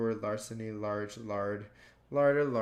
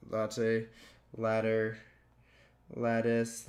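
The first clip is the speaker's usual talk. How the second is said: ordinary delivery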